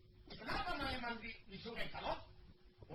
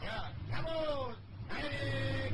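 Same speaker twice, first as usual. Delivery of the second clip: shouted go; 5 semitones higher, 6 dB louder, much slower than usual. Acted. Calling to depart.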